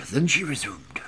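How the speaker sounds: plain reading voice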